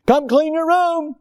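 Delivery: imitating a parent summoning; parent calling. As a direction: call the kid like mom from downstairs. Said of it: "Mom and Dad's voice".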